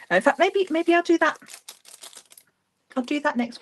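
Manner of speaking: excited. Real, not acted. Sudden idea.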